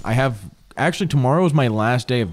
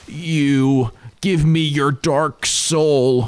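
very slow, drawn-out boss voice, pitched up; final boss; 5 semitones higher, much slower than usual. Acted. Final boss demanding the player's Dark Soul, slow and dramatic. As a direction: draw out each word like a demanding game villain. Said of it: "the final boss of Dark Souls three goes".

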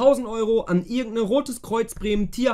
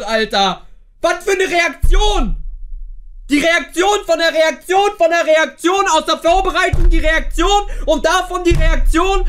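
furious shouting; 6 semitones higher than usual. Real, not acted. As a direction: rage at the coach at full voice. Furious rant about the coach.

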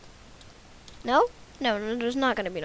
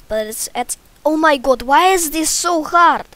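loud, high Russian-accented wail; 6 semitones higher, 13 dB louder than usual. Shown as a demonstration. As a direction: cry out in exasperation with a mock Russian accent. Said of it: "gonna just talk in Russian accent".

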